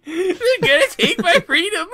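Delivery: high, laughing granny imitation; old lady voice. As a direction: mimic a frail old lady through laughter. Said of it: "you sounded like an old lady".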